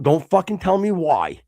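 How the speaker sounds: retold heated command; angry cutoff. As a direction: cut off his excuses hard. Retold confrontation, cutting off excuses.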